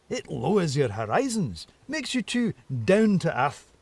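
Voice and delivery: high, musing, playful chatter; whimsical fox. Acted. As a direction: wander through the thought teasingly.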